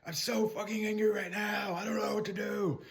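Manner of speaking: a demo of angry venting; performed angry statement. Shown as a demonstration. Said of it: "and say".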